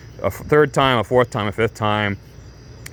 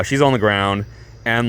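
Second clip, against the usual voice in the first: ordinary talk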